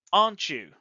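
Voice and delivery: "Aren't you" starts firm and falls away; falling intonation. Shown as a demonstration. Teacher expects you to say yes, falling intonation. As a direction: drop the pitch through "you", sure of agreement. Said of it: "if we wanted falling intonation".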